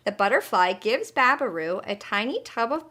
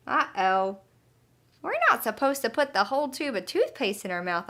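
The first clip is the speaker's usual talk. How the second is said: ordinary delivery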